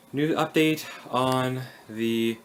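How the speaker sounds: ordinary talk, nothing marked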